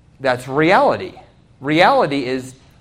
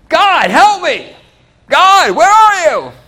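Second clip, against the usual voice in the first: loud, high, drawn-out plea; desperate cry to God; 9 semitones higher, 5 dB louder, slower than usual. Acted. Preacher acting out someone short on bill money, crying out desperately. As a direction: mock-desperate wail heavenward.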